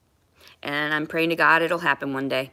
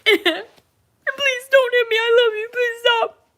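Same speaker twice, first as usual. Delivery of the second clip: much higher, slow, louder tearful begging; begging not to be hit; 6 dB louder, slower than usual. Retold or imitated. A victim begging the one hitting her, high tearful pleading. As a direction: plead like someone being beaten, near tears. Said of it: "It's like".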